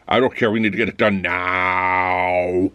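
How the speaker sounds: a hint of a slow, low robot boss; lightly voiced cold robot manager, slow and flat-out; the manager demanding the report, slow and low like a robot; robotic manager. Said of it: "Just like the possibly perfect robot".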